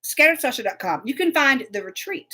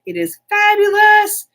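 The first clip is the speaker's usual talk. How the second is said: gushing; 10 semitones higher, 5 dB louder, slower than usual. Real, not acted. Gushing about her club.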